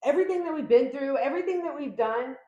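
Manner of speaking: high, loud, offended protest; indignant ex. Retold or imitated. A reader voicing the ex's disbelief, high and indignant. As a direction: protest like a hurt ex who can't believe it. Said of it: "They're like".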